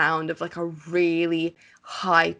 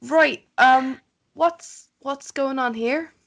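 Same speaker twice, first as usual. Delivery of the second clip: strained, grimacing; 6 semitones higher than usual. Acted. An adventurer, shaken by the wail, confronts the sisters. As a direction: shrug off the pain and demand answers. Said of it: "still grimace and be like".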